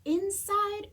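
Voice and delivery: plain reading voice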